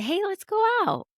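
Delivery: high-pitched, upbeat mock invite; eager invitation. Inviting a friend out for the evening, eagerly. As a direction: reenact a perky suggestion to a friend.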